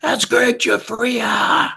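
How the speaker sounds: high, slow shout across the crowd; excited shout. Retold or imitated. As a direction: call out the name like a fan spotting a rock star. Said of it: "I hear some guy scream".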